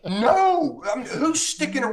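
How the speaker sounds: loud, high, half-laughing disbelief; incredulous. Real, not acted. Storyteller to host who asked if he left, incredulous. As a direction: pitch leaps up on an emphatic denial.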